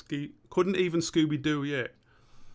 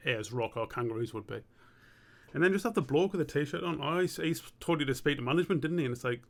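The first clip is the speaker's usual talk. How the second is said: ordinary delivery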